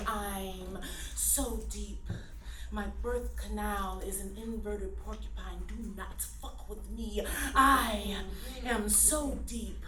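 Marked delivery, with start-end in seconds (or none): 0.1-2.0 soft and slow, dragging out so deep; mock-profound whisper of the refrain; hushed solemn refrain. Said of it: "this tone of voice". 2.7-7.2 low, hushed, very grave spoken-word delivery; mock-solemn poetry-slam tone; serious poem voice. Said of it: "I start all my poems in this tone of voice".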